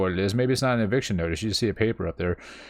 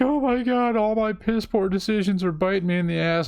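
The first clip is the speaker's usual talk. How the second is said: much higher, whiny panicked voice; mock distraught mom; 10 semitones higher than usual. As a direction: whine in mock despair. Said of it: "she's like".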